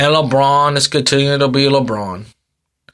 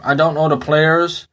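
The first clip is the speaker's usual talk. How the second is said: ordinary delivery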